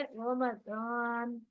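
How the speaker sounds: Ramzan drawn out very slowly; slow tajweed demo; stretched recitation. Shown as a demonstration. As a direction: hold the alif in Ramzan long. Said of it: "अलिफ मद को कैसा पढ़ा जाएगा".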